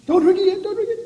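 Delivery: high, quick don't-drink-it call; urgent warning. Real, not acted. Stopping kids from drinking early.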